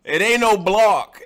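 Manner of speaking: "block" slow, high and loud, protesting the sound; disputed pronunciation. Shown as a demonstration. Guest defending own speech to the host, indignant "block". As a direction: loudly reject that way of saying "block". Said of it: "Y'all talk fu".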